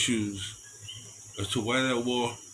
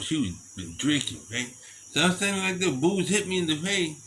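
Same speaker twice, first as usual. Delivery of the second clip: ordinary talk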